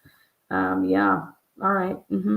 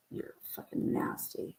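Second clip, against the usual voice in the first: hushed unvoiced whisper; 16 dB quieter than usual. Real, not acted. Whispering to the audience that it's nasty. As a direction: breathe it out with no voice.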